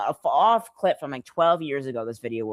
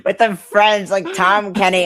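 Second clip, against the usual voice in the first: put-on a louder, higher French accent; 6 semitones higher, 9 dB louder, slower than usual. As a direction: push the French accent, loud. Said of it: "French accent".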